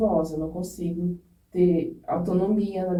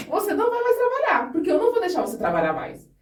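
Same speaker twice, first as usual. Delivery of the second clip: high-pitched imitation of a doctor forbidding; insistent mock doctor's order; firm prohibition; 11 semitones higher than usual. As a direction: lay down the law, pitched well up. Doctor forbidding work, acted.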